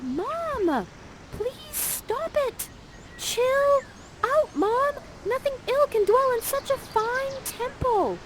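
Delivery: very high, whiny daughter voice; Miranda. Acted. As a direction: plead with Mom in a drawn-out squeal. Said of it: "And Miranda's like".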